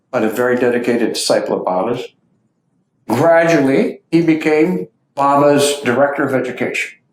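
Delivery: ordinary talk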